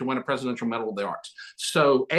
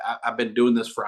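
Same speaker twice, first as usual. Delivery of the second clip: ordinary delivery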